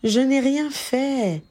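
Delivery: high, drawn-out protest of innocence; calming denial. A narrator's reading. Ginny reassures her indignant husband, calming denial. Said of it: "le calma Ginny".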